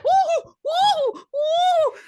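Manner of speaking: very high loud mock wooing. Imitating Loretta Devine's woo, high loud mock whoops. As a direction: whoop it like she's on hot coals. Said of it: "she said".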